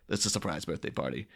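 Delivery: low, soft mock tip-off; hushed, understated spoiler; confiding aside. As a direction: drop the voice to leak a secret. Clooney secretly tips off his friend.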